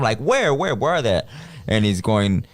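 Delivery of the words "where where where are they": retold yell. Searching the dark room, yelling.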